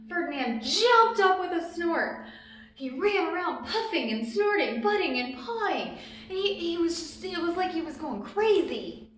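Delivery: excited. A narrator's reading. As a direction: build the wild bull moment with big energy. Bee stings Ferdinand, he goes wild.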